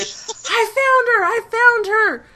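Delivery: high, slow calling out. Acted. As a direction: call out that you found her. GM voices someone calling out.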